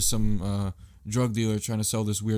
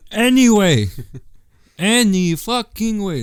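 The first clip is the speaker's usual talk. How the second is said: exasperated; 10 semitones higher, 11 dB louder, slower than usual. Real, not acted. Exasperated restart of the show.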